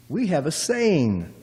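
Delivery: normal talking voice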